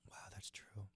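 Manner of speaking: hushed whisper. Real, not acted. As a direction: breathe it out under your breath. Hushed reaction after the call-out.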